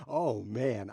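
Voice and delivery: laughing at the opponent's rook; real laugh. Real, not acted. Streamer amused by his opponent, laughing. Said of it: "I'm amused by that".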